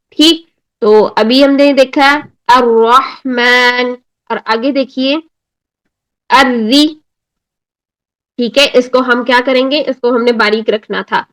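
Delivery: usual speaking voice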